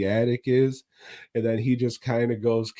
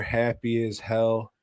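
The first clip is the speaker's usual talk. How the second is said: ordinary talk, nothing marked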